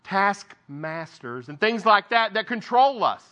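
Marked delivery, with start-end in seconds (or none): 0.0-1.5 "taskmasters" said slowly, each part separated; careful pronunciation. Real, not acted. Preacher retries a hard word, "taskmasters", slowly and carefully. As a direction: take care over "taskmasters", slowing to get it out. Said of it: "that's hard to say".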